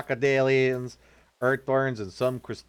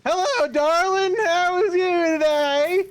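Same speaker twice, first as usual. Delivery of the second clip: much higher, louder flirty greeting; breathy vintage purr; 4 dB louder than usual. Acted. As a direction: coo at your darling. Flirty put-on high voice.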